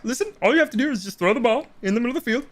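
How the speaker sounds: high, fast froggy puppet voice; Kermit voice. Retold or imitated. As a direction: rattle it off like a frog puppet. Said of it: "Like, Kermit".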